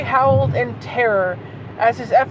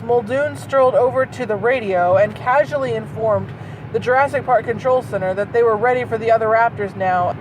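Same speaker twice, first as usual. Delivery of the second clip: normal talking voice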